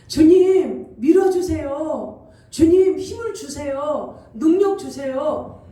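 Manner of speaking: slow, loud pleading to the Lord; fervent prayer. Pretend. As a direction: beg God for strength, drawn out.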